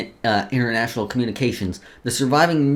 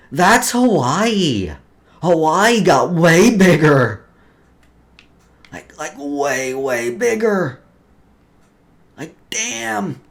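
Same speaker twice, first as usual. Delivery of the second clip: drawn-out, higher, louder amazement; amazed; 5 semitones higher, 4 dB louder, much slower than usual. Real, not acted. Streamer marvels at the giant Hawaii on the map, amazed and drawn out. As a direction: gush over how big it got.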